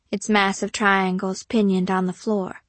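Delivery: ordinary delivery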